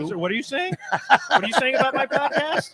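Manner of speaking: high, laughing mock indignation; playfully outraged, laughing; mock offended. Mock offense at host's remark.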